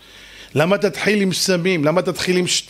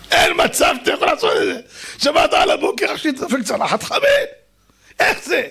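laughing; 8 semitones higher than usual. Real, not acted. Laughing while mocking.

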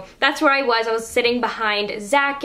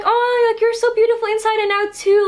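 much higher, louder gushing reply; gushing Taylor; 9 semitones higher, 3 dB louder than usual. Retold or imitated. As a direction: gush the compliment right back. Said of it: "And she was just like".

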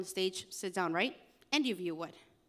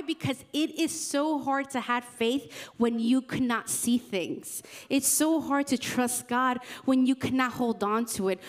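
louder, driving preacher's climax; full-force preaching; 3 semitones higher, 4 dB louder than usual. Acted. Youth pastor urging the youth to trust God, full preaching force. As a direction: push it home to the congregation.

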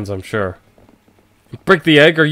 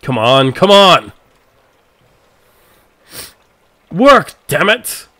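louder, higher frantic yells; desperate shouting; 7 semitones higher, 4 dB louder, much slower than usual. Acted. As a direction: strain against a dead switch. Frantic at the dead switch.

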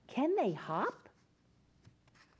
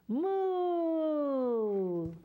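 big, long moo, higher and full; loud moo; 6 semitones higher than usual. Shown as a demonstration. Storyteller urges kids to moo loud, with a long booming moo. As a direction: moo as loud as you can. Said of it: "As loud as you can".

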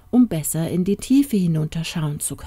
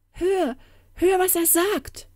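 very high, anxious call; frantic, pitched-up woman's plea; desperate cry; 13 semitones higher than usual. Acted. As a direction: implore him to listen. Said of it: "rief die junge Frau händeringend".

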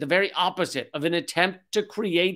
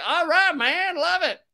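much higher, put-on mock accent; hammed-up eager agreement in a high voice; Zelensky; 11 semitones higher than usual. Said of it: "That's his accent".

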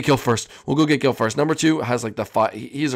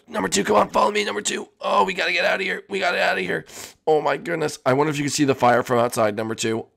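ordinary delivery

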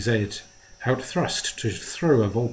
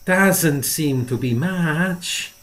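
slow, higher, silky purr; 6 semitones higher, 4 dB louder, slower than usual. A narrator's reading. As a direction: purr it out, teasing. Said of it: "he purred".

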